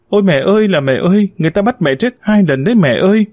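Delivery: high, fast crying wail for mother; wailing lament. Acted. Boy wails at his mother's funeral, high crying lament. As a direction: wail it out like a child rolling on the floor. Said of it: "lăn khóc trên nền nhà gào khóc".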